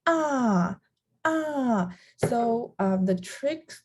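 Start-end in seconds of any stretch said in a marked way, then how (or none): 0.1-1.9 "ah" starting high and dropping sharply; falling fourth tone. Shown as a demonstration. Teacher shows the fourth tone, high falling "ah". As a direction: throw "ah" down from high pitch. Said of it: "the fourth tone is the falling tone".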